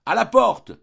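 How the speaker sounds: high, loud jeering shout; shouted outcry. A narrator's reading. Boarders driving the informer out, shouting. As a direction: shout to throw someone out. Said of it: "crièrent plusieurs voix".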